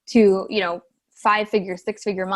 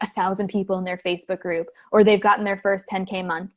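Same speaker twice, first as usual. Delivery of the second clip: normal talking voice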